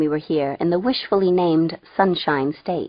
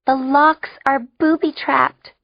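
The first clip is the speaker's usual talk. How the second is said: put-on high, slow girl's grumble; glum announcement; 8 semitones higher, 4 dB louder than usual. A narrator's reading. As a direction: announce it glumly, drawing it out. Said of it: "she announced glumly".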